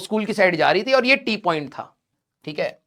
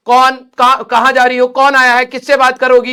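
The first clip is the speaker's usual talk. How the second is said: rapid-fire questions, louder, like a suspicious mother; mock nagging interrogation; 3 semitones higher, 7 dB louder than usual. Mother questioning daughter about going downstairs, loud nagging. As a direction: grill her like a nosy mom.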